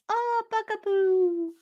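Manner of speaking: put-on high, slow sing-song toddler calling mom. Son greeting mom with a high toddler coo. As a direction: coo it like a little boy. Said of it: "He goes".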